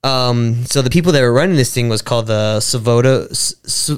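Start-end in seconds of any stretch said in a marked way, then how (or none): none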